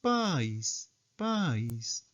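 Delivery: soft "País" gliding down; circumflex accent. Shown as a demonstration. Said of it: "¿Y circunflejo?".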